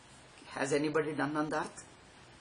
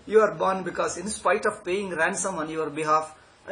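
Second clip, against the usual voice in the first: ordinary talk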